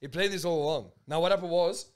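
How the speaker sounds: loud, worked-up storytelling; yelling. Real, not acted. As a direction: raise your voice as you launch into the story. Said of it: "you don't have to yell".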